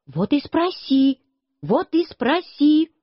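put-on mild childish shouting back, slow and high; narrated yelling. A narrator's reading. Boy yells back at Клавдия, insistent shouting. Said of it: "Я тоже стал кричать".

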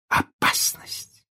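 slow, breathed, whispered question; alarmed echo. A narrator's reading. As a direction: echo the word in a hushed gasp of alarm. Mother echoes the alarming word.

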